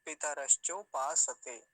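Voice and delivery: measured, firm, syllables joined up; normal connected recitation. Shown as a demonstration. Teacher showing the proper even tone, connected recitation. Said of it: "विधिवत कैसे बोलेंगे एक सामान्य स्वर में".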